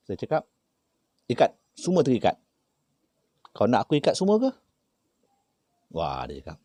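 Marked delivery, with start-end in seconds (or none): 1.3-4.5 loud, high challenge; retold threat. Healer challenging the spirit chief, loud threat. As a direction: dare him to be bound.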